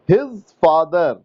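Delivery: his father drawn out very slowly; teacher dictating words slowly to a student; slow dictation. Shown as a demonstration. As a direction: dictate each word very slowly. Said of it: "teacher dictating sentence slowly".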